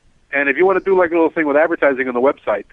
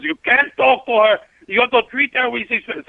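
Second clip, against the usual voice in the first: imitating the drunk guy; 9 semitones higher than usual. Drunk guy demanding respect.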